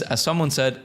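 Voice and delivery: ordinary talk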